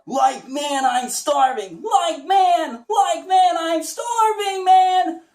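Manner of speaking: very high, loud, slow yell; high cartoon stoner shout; high Shaggy voice. Shown as a demonstration. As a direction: push to the top and belt it. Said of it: "so we have the".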